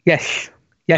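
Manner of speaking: yes said with a whistling lateral lisp on the s. Shown as a demonstration. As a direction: let the s in yes slide out the side of the cheek. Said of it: "it's called a lateral lisp".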